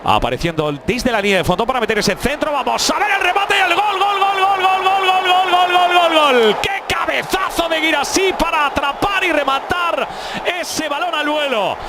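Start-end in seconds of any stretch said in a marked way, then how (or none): 2.5-6.6 goal scream. Real, not acted. Goal scored, commentator erupts. 6.6-11.8 excited shout. Real, not acted. Celebrating goal details.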